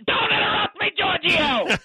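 sudden high, hammed-up angry yell; indignant outburst. Draft analyst scolding host for interrupting, indignant. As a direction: explode at being cut off.

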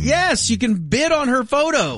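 high, eager interjection; excited. Real, not acted. Co-host cutting in about an eBay photo, excited. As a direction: jump in high with an excited confirmation.

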